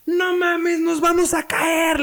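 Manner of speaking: high yelled panic on the ride; shouting to scare my cousin; retold scream. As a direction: scream it as the coaster seems to break. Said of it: "le grité".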